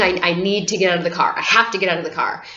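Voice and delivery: rushed and breathless, fast; hurried plea to get out; fast panicked rush. Retold or imitated. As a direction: blurt it out in a panic.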